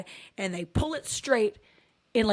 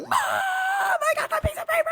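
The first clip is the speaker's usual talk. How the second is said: excited shout. Retold or imitated. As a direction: yell it out in triumph like a kid. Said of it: "ran out going".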